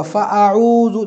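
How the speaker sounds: फ़अऊज़ु said slowly with the hamza held; slow demo of fa'uzu. Shown as a demonstration. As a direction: stretch फ़अऊज़ु out syllable by syllable. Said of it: "इसको फ़ऊज़ु नहीं पढ़ोगे".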